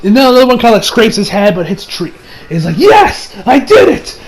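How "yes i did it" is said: triumphant cheer. Retold or imitated. Hayato cheers a hit.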